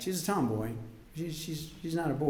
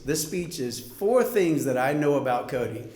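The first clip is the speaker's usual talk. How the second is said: normal talking voice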